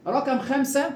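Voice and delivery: ordinary talk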